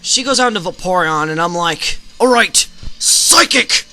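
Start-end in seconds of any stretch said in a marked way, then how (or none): 2.2-3.9 drawn-out, high, loud battle cry; slow, soaring call of the move; excited call. Acted. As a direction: shout the move like a trainer hyped to win. Calling his attack mid-battle.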